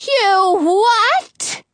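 high, outraged yelp; shrieked-up question from the witch; yelling. A narrator's reading. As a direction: yell it in shocked disbelief. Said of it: "Frau Gothel yells".

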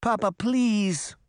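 high, drawn-out plea; crying out. A narrator's reading. Pinocchio begs his papa, crying out. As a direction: beg in a stretched, high child's whine. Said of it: "Pinocchio cried".

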